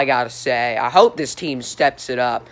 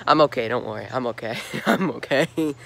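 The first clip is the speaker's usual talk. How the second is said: laughing it off; 4 semitones lower, 4 dB quieter than usual. Real, not acted. Laughing it off after slipping.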